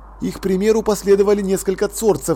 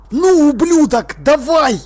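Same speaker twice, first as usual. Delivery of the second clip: hissed; 9 semitones higher, 6 dB louder, slower than usual. A narrator's reading. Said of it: "прошипел он".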